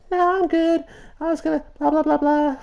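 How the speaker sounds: put-on squeaky little girl voice, way up high. Little girl shrugging off the player, high and squeaky. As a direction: brush him off in a tiny high voice. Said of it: "She's like".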